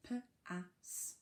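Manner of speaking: p-ass split, slow, into p and ass; segmented word. Shown as a demonstration. Teacher to reception class, sounding out p-ass. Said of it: "it's at the end".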